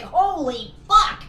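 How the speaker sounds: slow, high, loud exclamation; stunned, drawn-out reaction; retold disbelief. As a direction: stretch both words out in disbelief. Retells own stunned reaction.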